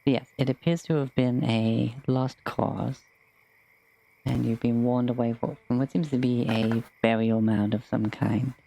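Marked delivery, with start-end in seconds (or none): none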